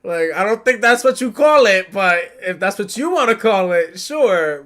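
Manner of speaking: high, very loud sarcastic retort; mock arguing. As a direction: shout back as if bickering. Acted bickering retort to Sabo.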